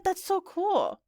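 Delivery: high, drawn-out admiration; wowed, stretched-out words; impressed. Acted. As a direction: gush slowly over how cool it is. Girl impressed by friend's birthplace.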